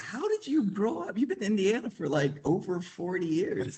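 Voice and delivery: high-pitched exasperated disbelief, breaking into a laugh; mock incredulous. Son asking mom about her forty years in Indiana, high mock incredulity.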